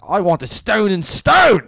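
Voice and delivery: loud, high, slowed indignant shout; mock irate demand. Irate headliner rejecting the comics he was sent, mock angry shout.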